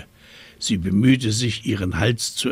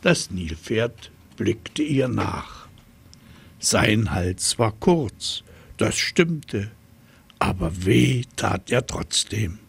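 ordinary delivery

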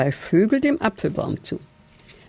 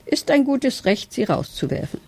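higher start, then falling off; calling after; 6 semitones higher than usual. A narrator's reading. Father badger adds his call, starting higher. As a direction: chime in behind her. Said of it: "Papa Dachs rief hinterher".

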